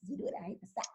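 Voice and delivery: soft whisper, an aside. Acted. Host whispering an aside to listeners about Southern cooking. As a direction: let the room in on a secret.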